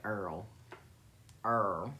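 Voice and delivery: oil pronounced "Earl". Retold or imitated. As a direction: turn oil into "Earl", with an r-coloured vowel. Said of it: "it sounded like Earl".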